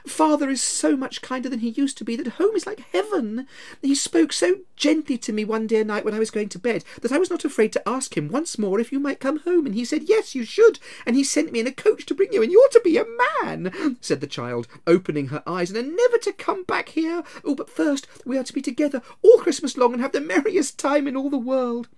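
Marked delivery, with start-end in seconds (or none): 0.1-14.0 put-on high, loud, excited child voice; a small sister pouring out happy news; gleeful little girl. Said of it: "brimful of glee". 15.7-21.9 put-on high, loud, excited child voice; a small sister pouring out happy news; gleeful little girl. Little Fan continues.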